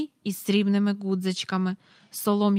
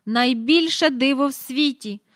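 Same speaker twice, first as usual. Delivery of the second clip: loud, thrilled cry; exclaiming; 4 semitones higher, 6 dB louder than usual. A narrator's reading. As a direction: announce the wonder. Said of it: "вигукнув він". (радісний вигук)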